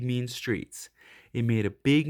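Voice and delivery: ordinary delivery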